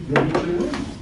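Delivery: ordinary talk, nothing marked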